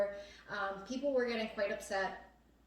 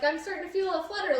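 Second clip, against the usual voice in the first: quick, higher light imitation of a friend; imitating Claire; 8 semitones higher, 6 dB louder, faster than usual. Quoting Claire's earlier anxiety, lightly imitated higher. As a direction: lightly mimic her nervous remark. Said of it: "Claire was like".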